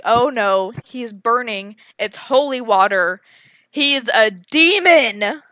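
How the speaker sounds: loud, emphatic reveal, slowing at the end; dramatic shock. Retold or imitated. Teller revealing the twist to friend, dramatic shock. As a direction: build to a stunned, drawn-out punch.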